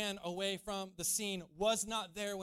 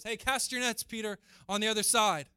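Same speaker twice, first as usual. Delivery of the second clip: raised voice carrying over water; calling out; 7 dB louder than usual. Acted. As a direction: holler it from the shore. Jesus calls again from shore.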